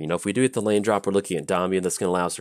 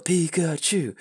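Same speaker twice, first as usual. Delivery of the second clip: high, drawn-out mock cheer; stretched-out mock yell, pitched way up; imitated crowd yelling; 10 semitones higher, much slower than usual. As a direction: chant it like a parade crowd. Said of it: "people started yelling".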